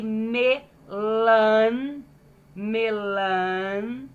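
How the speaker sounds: "melancia" very slow, with a long held "lan"; syllable by syllable. Shown as a demonstration. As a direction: stretch and separate each syllable of "melancia". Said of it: "Falei sílaba por sílaba".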